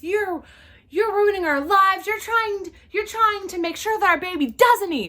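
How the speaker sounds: yelled high and loud, every word hammered; furious yelling. Retold or imitated. Irate mother yelling at the crew, furious and loud. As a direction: scream it at the crew like your life is being ruined. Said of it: "She's yelling".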